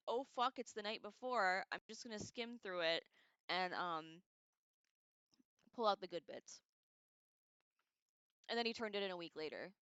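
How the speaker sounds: ordinary talk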